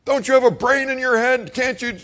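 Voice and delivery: hammed-up angry father scolding boy; imitating angry dad. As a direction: yell like a furious father. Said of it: "He's like".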